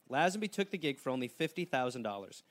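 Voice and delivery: ordinary talk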